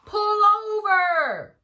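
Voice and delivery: very loud, high, drawn-out command; bullhorn shout. Acted. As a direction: bark it through a megaphone at the driver. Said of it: "He shouted with the bullhorn".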